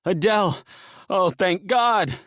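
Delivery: tearful relief at finding his wife; choked cry of relief. Acted. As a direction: cry out her name, voice catching. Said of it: "A cry caught in his throat".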